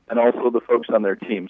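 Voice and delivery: usual speaking voice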